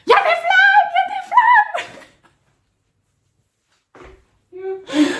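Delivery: very high, loud alarmed shout fading; mock panicked yell. Passer-by shouting about the flames, high mock panic. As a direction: squeal it like a panicking onlooker. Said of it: "il commence à crier".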